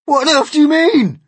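high, loud alarmed cry. A narrator's reading. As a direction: demand an explanation at full volume. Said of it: "they cried".

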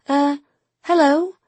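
unsure greeting to a stranger; nervous. Acted. Nina greets the grumpy van seller, nervously. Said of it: "said Nina nervously".

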